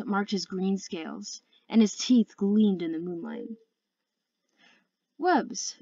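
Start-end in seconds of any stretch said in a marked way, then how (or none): 5.2-5.8 pleasant lilt, high and falling; falsely pleasant. A narrator's reading. Other Sea Wing greets Webbs in a pleasant voice. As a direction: call the name pleasantly, pitched well up. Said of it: "in a pleasant voice".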